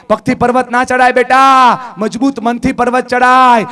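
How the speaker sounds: put-on a mother pouring strength into her child at full voice; mother's rousing cry. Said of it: "હાથને મજબૂત કરીને માં બોલી".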